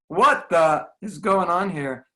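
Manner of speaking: exasperated outburst. Retold or imitated. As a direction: spit it out in disbelief. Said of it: "you just wanna say".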